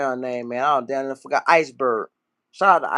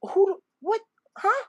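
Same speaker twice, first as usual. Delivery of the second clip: very high falsetto, halting confusion; baffled woman listener; 5 dB quieter than usual. Retold or imitated. Imitating a Memphis woman hearing Florida slang, baffled falsetto. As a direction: squeak out bewildered fragments in falsetto. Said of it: "Bitch could be like".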